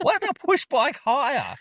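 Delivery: very high, slow, baffled echo; amazed. Retold or imitated. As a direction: mimic a friend's bewildered question. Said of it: "Josh was amazed by that too".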